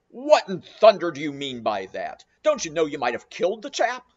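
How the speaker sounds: rough ship's captain dressing him down; captain rebuking the harponeer; angry captain. Acted. Angry captain scolds Queequeg.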